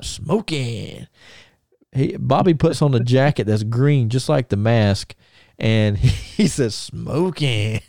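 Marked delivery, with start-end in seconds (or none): 0.0-1.1 drawn-out mock catchphrase; movie catchphrase. Host quotes The Mask's catchphrase, drawn out in imitation. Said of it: "the part where he comes out smoking". 6.7-7.8 imitated country Alabama accent; Bobby Eaton. Wrestler copies film catchphrase, imitated country Alabama accent. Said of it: "in that Bobby Eaton country Alabama accent".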